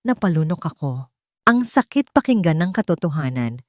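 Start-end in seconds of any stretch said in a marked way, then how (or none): none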